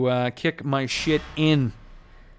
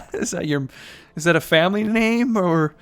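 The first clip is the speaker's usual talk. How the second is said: mock awkward Eomer; 6 semitones higher than usual. Eomer's awkward flirting continued.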